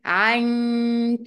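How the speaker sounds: "عنك" with a long held nasal hum for the n; hidden noon with ghunnah. Shown as a demonstration. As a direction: stretch the hidden n of "عنك" through the nose. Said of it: "بنخبيها شوية".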